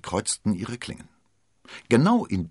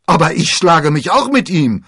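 protesting; 12 semitones higher, 15 dB louder than usual. Acted. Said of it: "protestierten Porthos und Aramis".

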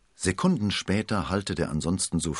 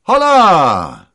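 loud high bark of a commander; shout; 12 semitones higher, 17 dB louder than usual. Acted. The cardinal's commander halts the duellists, loud shout. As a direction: stop them in their tracks. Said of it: "schrie er".

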